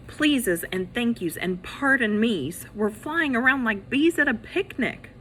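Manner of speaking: ordinary delivery, nothing marked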